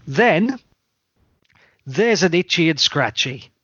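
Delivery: ordinary delivery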